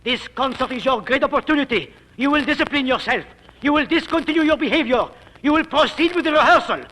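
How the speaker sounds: ordinary talk, nothing marked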